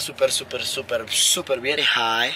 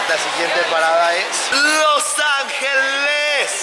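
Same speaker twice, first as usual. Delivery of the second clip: announcer; 12 semitones higher, much slower than usual. Acted. Announces next stop.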